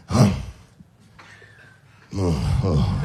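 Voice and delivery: [name] low reply. Retold or imitated. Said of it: "He goes".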